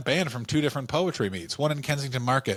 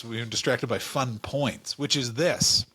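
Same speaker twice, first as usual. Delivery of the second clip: normal talking voice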